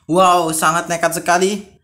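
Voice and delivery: ordinary delivery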